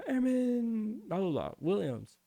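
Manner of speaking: high, drawn-out call of an airman's name; instructor calling out. Retold or imitated. Drill instructor calls out the trainee's name, high and drawn out.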